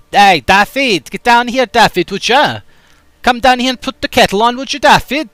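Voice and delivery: high, loud Welsh calling; Welsh accent. Shown as a demonstration. Chat asks for Welsh, he calls Daffy loudly. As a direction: shout up for Daffy to put the kettle on. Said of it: "Can you do Welsh?".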